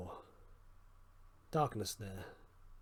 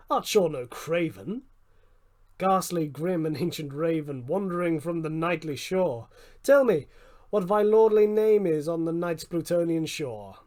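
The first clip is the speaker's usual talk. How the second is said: louder, higher demand to the raven; loud mocking challenge; 4 semitones higher, 11 dB louder than usual. A narrator's reading. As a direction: taunt the bird, then demand its name. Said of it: "I said".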